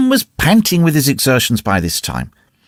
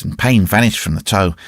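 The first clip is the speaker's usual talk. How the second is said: ordinary delivery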